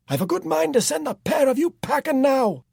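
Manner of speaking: very high, loud threat; shouting. A narrator's reading. Jack threatens to throw the pair out, shouting. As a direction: yell the threat in fury. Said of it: "Jack shouted".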